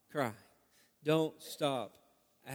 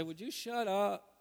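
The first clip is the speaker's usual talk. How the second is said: exasperated snap at son; 5 semitones higher, 7 dB louder than usual. Retold or imitated. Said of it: "I finally look at him at some point and say".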